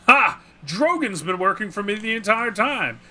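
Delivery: loud, high triumphant villain gloat; hammed-up evil reveal. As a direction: crow over the heroes like a smug mastermind. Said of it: "And he's like".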